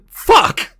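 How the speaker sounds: snapping at himself after fumbling a name; frustrated outburst. Real, not acted. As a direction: blurt it out in real frustration.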